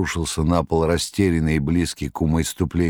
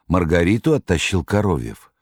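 ordinary delivery, nothing marked

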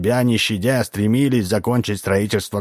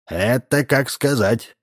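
cautious; 5 semitones higher, slower than usual. Acted. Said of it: "осторожно возразил Удалов".

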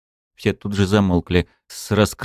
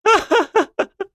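high shrill triumphant laugh; ringing loud laugh. Acted. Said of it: "звонко и громко рассмеялась она".

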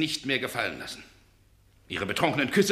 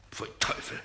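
disgusted mutter; 7 dB quieter than usual. Acted.

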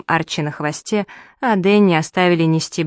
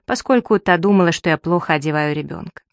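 plain reading voice

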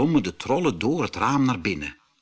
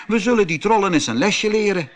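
bold and eager; 8 semitones higher, 5 dB louder, faster than usual. A narrator's reading. Otto vows to teach trolls.

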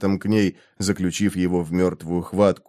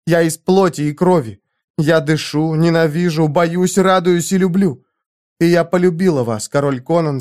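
put-on higher, heartfelt girl's voice; a concubine baring her soul; earnest young woman; 6 semitones higher than usual. A narrator's reading. Zenobia confesses her love.

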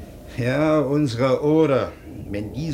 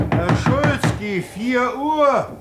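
calling out; 10 semitones higher, slower than usual. Acted. Waking guest at four.

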